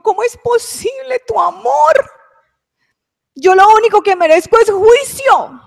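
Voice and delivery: high, loud, slowed cry of disbelief; overwhelmed weeping exclamation; tearful outcry. Retold or imitated. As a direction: wail it out, overcome by love. Overwhelmed by God's love, weeping.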